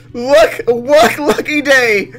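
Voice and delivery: ordinary talk, nothing marked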